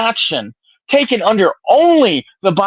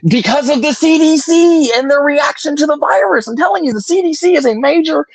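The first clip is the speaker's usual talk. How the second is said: higher-pitched, heated argument; heated insistence; 8 semitones higher than usual. Real, not acted. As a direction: insist over an opponent's objection. Insisting over opponent in argument.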